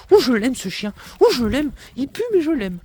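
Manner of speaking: cooing at dog. Real, not acted. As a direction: gush over the smelly dog. (voix aiguë attendrie pour le chien)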